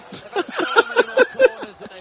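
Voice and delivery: high-pitched burst of laughter. Real, not acted. Laughs at own sticky tongue.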